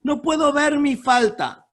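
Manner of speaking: loud, high, slow plea "No puedo ver mi falta"; impassioned loud pleading prayer; pleading believer. Acted.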